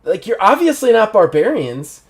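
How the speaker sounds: a frightened doctor grasping for answers; nervous doctor Kelly. Acted. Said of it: "She's like".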